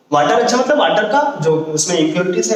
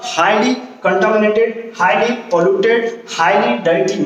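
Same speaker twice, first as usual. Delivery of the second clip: slow emphasis; much slower than usual. Shown as a demonstration. Teacher stressing key terms slowly.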